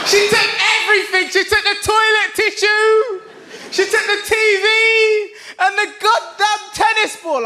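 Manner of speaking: hammed-up wail of a wronged father; wailing dad. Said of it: "And he says".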